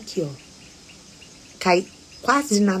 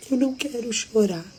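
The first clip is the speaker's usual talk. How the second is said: higher, imitated tearful protest; holding back tears; 6 semitones higher than usual. Husband who almost never cries, retold as holding back tears. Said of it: "ele começou a chorar".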